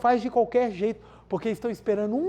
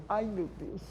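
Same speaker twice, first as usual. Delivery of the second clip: mock dread; 9 dB quieter, slower than usual.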